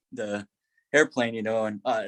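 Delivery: normal talking voice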